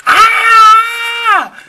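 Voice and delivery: loud high scream ah; wind-blasted scream. Pretend. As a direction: scream as wind hits face. Face blasted by tornado wind.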